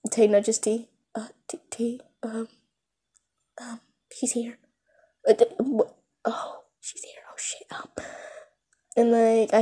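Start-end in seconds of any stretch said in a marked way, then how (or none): 1.1-8.1 hushed unvoiced whispering; secretive excited whisper. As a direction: whisper so she won't hear. Boys whispering about her.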